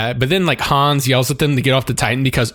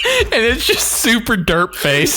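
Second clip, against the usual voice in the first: high, slow, laughing through each word; giggling helplessly; 10 semitones higher, slower than usual. Real, not acted. Describing the horse's derp face to the co-host, laughing.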